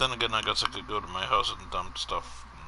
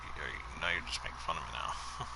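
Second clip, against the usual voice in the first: whispered complaint; hushed, breathy grumble; whispering; 6 semitones lower, 7 dB quieter than usual. Real, not acted.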